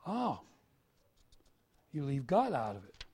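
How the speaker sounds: normal talking voice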